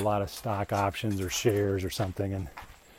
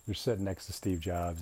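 ordinary talk, nothing marked

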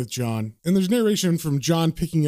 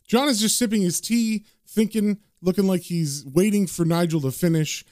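usual speaking voice

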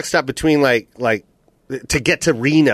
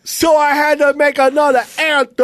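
mocking rapper brag; 12 semitones higher than usual. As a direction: parrot him like a boastful rapper.

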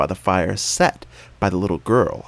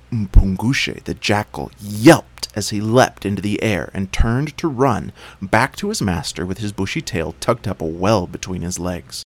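ordinary delivery, nothing marked

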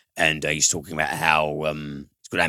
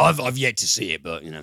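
ordinary talk, nothing marked

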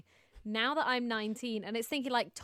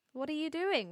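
ordinary talk, nothing marked

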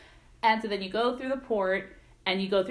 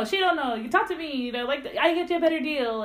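a bit higher, lightly faked island accent; pushy seller undercutting a rival, a touch of Bahamian accent; horrible Bahamian accent; 5 semitones higher than usual. Seller luring tourists from rival booth, mock Bahamian accent. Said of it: "I apologize if I'm doing a horrible accent".

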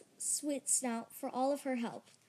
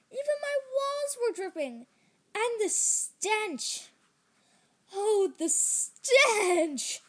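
slow, louder disgusted groan; 5 semitones higher, 5 dB louder, slower than usual. A narrator's reading. Jerry surveys his flooded house, disgusted. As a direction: drag out the stench in revulsion.

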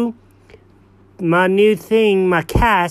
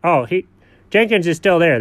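ordinary talk, nothing marked

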